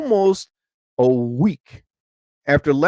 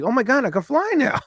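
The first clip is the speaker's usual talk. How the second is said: thrilled; 10 semitones higher than usual. Retold or imitated. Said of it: "I was like".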